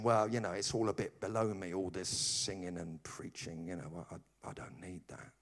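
low, soft, mildly superior brush-off; superior, dismissive. Acted. Preacher mimicking Christians who snub church, quietly superior. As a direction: trail away as if it's beneath you. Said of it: "felt themselves a bit too superior".

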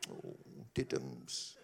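patronising coo from the psychotherapist; pitying. Retold or imitated. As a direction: talk down to him like a poor little child. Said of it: "with this look of pity, like".

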